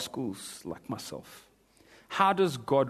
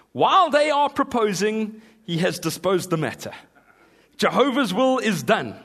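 loud, high, grand pulpit oration; Spurgeon's preaching; 6 semitones higher, 5 dB louder than usual. Retold or imitated. Preacher reads Spurgeon aloud, grand oratory. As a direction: thunder it like the Prince of Preachers. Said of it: "let the Prince of Preachers speak".

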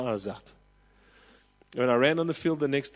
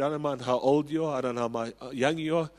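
usual speaking voice

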